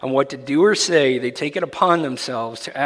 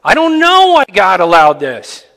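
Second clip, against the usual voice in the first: louder and higher, proclaim not knowing; bold declaration; 13 semitones higher, 9 dB louder than usual. Shown as a demonstration. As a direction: declare it proudly, loud and high. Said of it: "Get comfortable with it".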